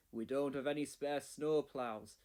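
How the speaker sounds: a hint of a firm boss; lightly voiced stern controller; Sir Topham Hatt. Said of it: "said Sir Topham Hatt".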